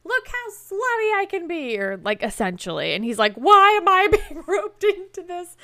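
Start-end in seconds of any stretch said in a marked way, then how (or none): 0.0-1.8 mock brazen show-off, pitched way up; making a scene. She grabs Winston in the hallway, making a scene. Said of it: "making a big scene in the hallway". 3.3-5.5 high, loud bewildered yelp with a laugh; mock baffled complaint, pitched up and loud; confused protest. Imitating Winston dragged into the scene, very confused. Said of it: "Winston is very confused".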